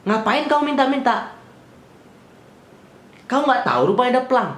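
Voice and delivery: mock-harsh officer brushing off the request; rude cop voice. Policeman scolding the driver for asking, imitated harsh voice. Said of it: "Dia ngomongnya gini".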